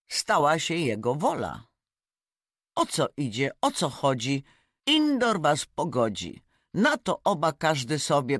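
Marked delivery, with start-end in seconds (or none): none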